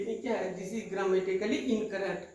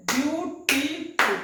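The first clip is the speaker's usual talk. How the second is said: Beautiful higher, slow, chopped as ब्यू-टी-फुल; clapped syllables; 4 semitones higher, 4 dB louder, slower than usual. Shown as a demonstration. Teacher demonstrating clapping method, beautiful in three slow beats. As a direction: beat out beautiful one syllable at a time. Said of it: "clapping method से".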